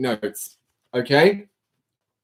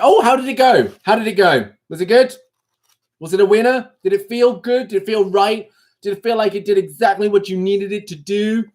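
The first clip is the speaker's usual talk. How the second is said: high, gushing run of questions; mock eagerness; 7 semitones higher than usual. Streamer quizzing viewers about how it went, mock-eager. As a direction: pepper them with eager questions, pitched up.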